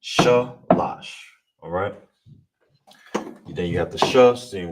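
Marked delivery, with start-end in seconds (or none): 0.0-1.1 "Shalash" said a little higher, stress on the last syllable; modelled word. Shown as a demonstration. Teacher models the Hebrew word for three. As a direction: model "Shalash" clearly for students. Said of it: "how you will say it is".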